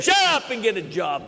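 high, forceful father's rebuke; hammed-up dad shutting down the whining; stern father. Father tells complaining child to get working, stern mock rebuke. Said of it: "my father would tell me".

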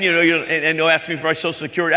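usual speaking voice

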